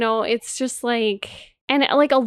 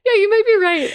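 laughing quote; 11 semitones higher, 5 dB louder than usual. Retold or imitated. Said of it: "And then he's like".

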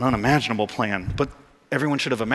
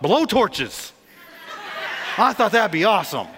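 laughing; 6 semitones higher than usual. Real, not acted. Pastor laughing at his own idea.

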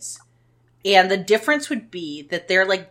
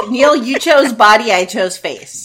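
smug retort; 4 semitones higher, 7 dB louder, slower than usual. Pretend. Mock retort to husband.

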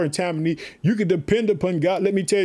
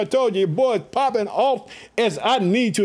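usual speaking voice

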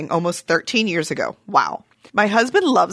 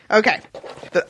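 normal talking voice

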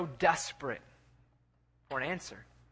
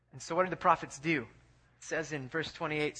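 ordinary talk

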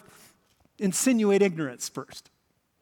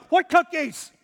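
caught denying; 9 semitones higher, 5 dB louder, slower than usual. Retold or imitated.

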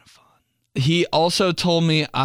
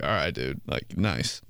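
mock grudging calm; 10 semitones lower, 8 dB quieter than usual. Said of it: "play it off like".

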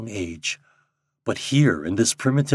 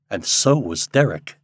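ordinary delivery

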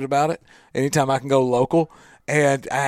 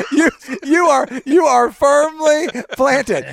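laughing; 9 semitones higher, 5 dB louder, slower than usual. Real, not acted. Laughing at the Undertaker joke.

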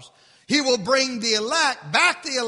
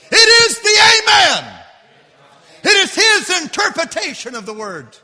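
high, very loud proclamation, easing slightly; full-force preaching; 9 semitones higher, 12 dB louder than usual. Acted. Preacher proclaiming the Amen to the congregation, shouting. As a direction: shout it out to the whole church.

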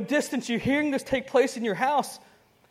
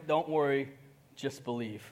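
soft Jesus voice; 7 semitones lower, 8 dB quieter than usual. Acted. Jesus answering the doubter about bills, soft and low. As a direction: lower and fading softer, unbothered. Jesus repeated calm reply.

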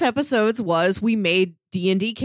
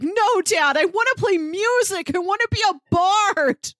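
put-on high, pleading rebellious teen voice, pitched up; whiny bard son; 11 semitones higher than usual. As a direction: beg Dad like a sulky young elf who wants to play music. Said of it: "and instead he's like".

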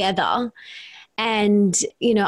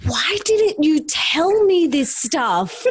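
exasperated outburst; 6 semitones higher, slower than usual. Retold or imitated. To her mum, a slow raised exasperated cry. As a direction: demand to know why nobody said it. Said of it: "I'm like".

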